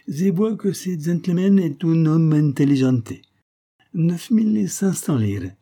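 put-on high, loud Italian-accented haggling; Italian shopkeeper, amused. A narrator's reading. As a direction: flatter the customer as an Italian vendor.

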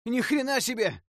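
high, loud startled yell. A narrator's reading. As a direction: yell out in shock. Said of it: "завопил кто-то из игроков".

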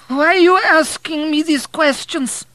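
loud, very high-pitched challenge; demanding old woman. A narrator's reading. Old woman questioning her prying visitor, demanding. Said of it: "she demanded".

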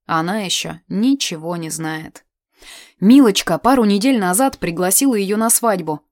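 plain reading voice

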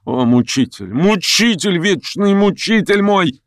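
высокий надрывный вопль упрёка; выкрикивай упрёк всё выше, растягивая конец; неистовый крик. A narrator's reading. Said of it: "крикнула она неистово". (неистово, с нарастающим надрывом)

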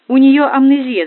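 loud, high cry of alarm; shouting. A narrator's reading. Машка announces mum's amnesia, shouting. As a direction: shout the diagnosis out. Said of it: "закричала Машка".

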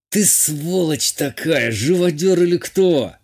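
angry, drawn-out accusation, pitch rising; seething through pain. A narrator's reading. Wounded captain berates the clumsy healer who hurt him, seething. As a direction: spit out the insult in pain, stretching the words. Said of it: "процедил Игалик".

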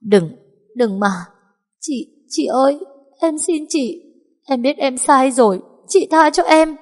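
high, strained begging; choked pleading. Acted. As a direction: squeeze the words out while being choked. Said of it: "van xin cố dặn từng chữ méo mó".

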